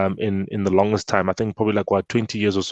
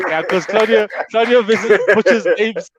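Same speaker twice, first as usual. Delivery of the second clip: 1 teasing; 8 semitones higher, 3 dB louder than usual. Real, not acted. Teasing Claudio about names.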